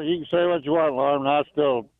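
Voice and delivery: ordinary talk, nothing marked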